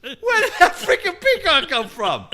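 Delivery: high, loud incredulous outburst; retold astonishment. Retells discovering the peacock.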